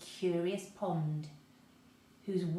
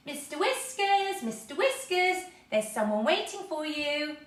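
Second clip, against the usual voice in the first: calling down the hole; 8 semitones higher, 6 dB louder than usual. A narrator's reading. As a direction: call it down into the burrow.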